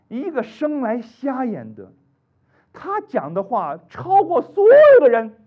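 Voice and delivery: slow, loud, climbing to a high cry; emphatic preaching. Acted. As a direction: drive the point home slowly. (缓慢而激昂的讲道)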